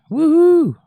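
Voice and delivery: high, loud "woohoo" cheer; whooping woohoo. Real, not acted. Host cheers the newcomer in chat, whooping woohoo. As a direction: let out a big ringing whoop.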